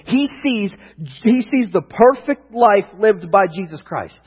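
loud, emphatic preacher delivery; preaching. Real, not acted. Preacher telling the church of imputed righteousness, emphatic preaching. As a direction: preach it out with punch and conviction.

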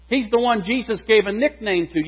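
normal talking voice

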